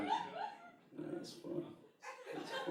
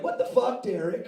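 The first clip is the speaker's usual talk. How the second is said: incredulous outburst; 10 semitones higher, 6 dB louder than usual. Retold or imitated. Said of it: "You're like".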